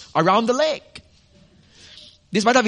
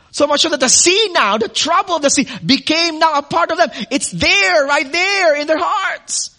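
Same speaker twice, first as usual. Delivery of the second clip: preaching at full force; 7 semitones higher than usual. Acted. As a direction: preach it up, rising. Preaching builds intensity.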